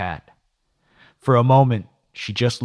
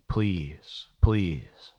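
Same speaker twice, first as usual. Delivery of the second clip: begging. A narrator's reading. Secretary pleading with her lover, mildly begging. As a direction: plead gently, each word on its own.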